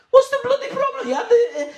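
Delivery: put-on high, loud, laughing shout of an outraged old man; laughing mock shout. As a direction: yell it while cracking up. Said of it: "a full-blown shouting match".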